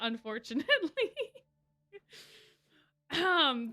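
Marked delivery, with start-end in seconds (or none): 0.7-1.4 a quick real laugh at the interruption; amused laugh. Real, not acted. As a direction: laugh it off lightly.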